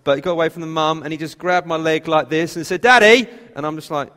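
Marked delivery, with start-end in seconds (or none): 2.8-3.3 put-on loud high child's cry of Daddy; excited little boy calling out. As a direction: shout it like a toddler grabbing a leg.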